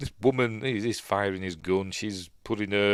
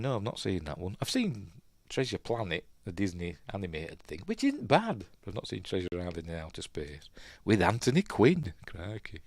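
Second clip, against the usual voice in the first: ordinary talk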